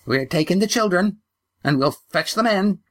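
put-on high, shrill old woman. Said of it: "her voice shrill".

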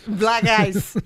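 put-on light echo of the Italian dad accent.

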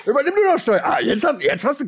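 high mocking dare; taunting. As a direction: dare a toddler to drive. Taunting the small child.

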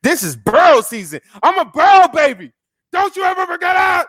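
high, loud fan shout; excited shout. Real, not acted. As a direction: yell it like a proud fan. Fan hyping his team.

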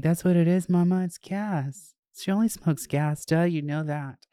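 high, playful know-it-all; joking to her mom about weed called gas, high and sassy; sassy teasing. Retold or imitated. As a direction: tease Mama with a smug duh. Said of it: "And I'm like".

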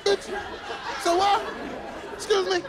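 high, sassy comeback; sassy indignant. Retold or imitated. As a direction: snap back with attitude.

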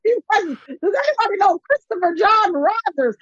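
high, near-crying plea; mock panic. Begging Twitter for a designer, high mock-desperate whine. Mock frantic tweet read out.

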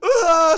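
loud, high mock wail; hammed-up crying shriek; imitated scream-cry. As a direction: imitate someone bawling at full volume. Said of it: "scream crying".

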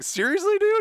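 high, loud incredulous question; mock disbelief. Band hearing the drummer's news, high mock disbelief. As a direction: squeak it out in disbelief. Acts out band's disbelieving reply.

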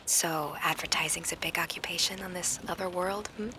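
soft playful whisper; whispering on the phone. Acted. Joins the whispered phone game.

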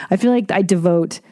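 usual speaking voice

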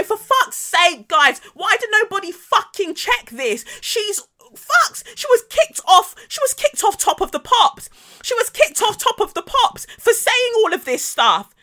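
high-pitched, frantic mock tirade; imitated angry rant. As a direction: act out someone losing it at the team. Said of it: "shouting up and down".

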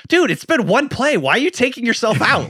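exasperated fan yelling at a player; incredulous. Retold or imitated. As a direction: pitch it up in mock outrage at the TV. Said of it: "I'm like".

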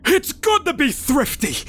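a hint of a lecturing father; stern father. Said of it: "admitted my father".

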